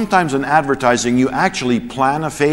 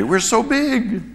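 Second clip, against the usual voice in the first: higher, slow mock pride; mock boast; 11 semitones higher, slower than usual. Preacher mocking the church's pride, high and slow.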